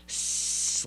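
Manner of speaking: long unvoiced hissing sound; alligator hiss. Alligator at the front door, imitated hissing. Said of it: "it started hissing".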